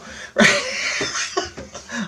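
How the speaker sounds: high, long real laugh. Real, not acted. Laughing at his own story's punchline, high laugh. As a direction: burst out laughing after your own punchline.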